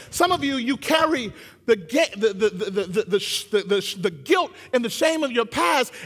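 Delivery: high, loud, driving sermon delivery; full-force preaching. Real, not acted. Preacher urging congregation from mistake to mission, impassioned and loud. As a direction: preach it hard, punching the key words.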